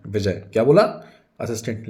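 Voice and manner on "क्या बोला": loud, rising challenge; angry. Acted. Vijay reacts to an insult, angry. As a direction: throw it back angrily. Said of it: "विजय को गुस्सा आ जाता है".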